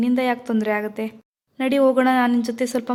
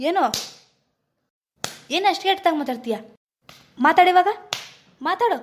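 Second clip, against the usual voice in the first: angry demand; 6 semitones higher than usual. Acted. Shivu beating the goon, demanding he talk, furious. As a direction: force him to answer.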